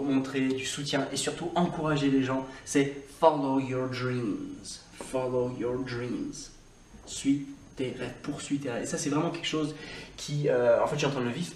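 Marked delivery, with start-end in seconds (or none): 3.2-6.3 slow, tender "Follow your dreams". Shown as a demonstration. Teacher sending gentle encouragement to learners. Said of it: "montrer, euh, de l'amour".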